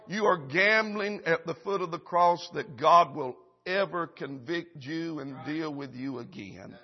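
ordinary delivery

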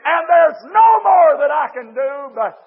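high, drawn-out preacher's shout; preached cry to God. Retold or imitated. Preacher voices a weary man crying to the Lord, shouted. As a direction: cry out to the Lord, long and loud. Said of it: "and said".

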